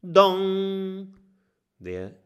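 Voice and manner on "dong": high ringing DONG; booming clock chime. A narrator's reading. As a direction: ring it like a clock.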